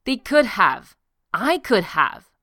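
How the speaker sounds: heated rebuke; angry retort. A narrator's reading. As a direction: fire it back, loud and hard.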